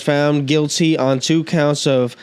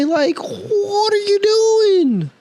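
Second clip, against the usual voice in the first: exasperated disbelief; much slower than usual. Real, not acted. Ranting about unfixed road.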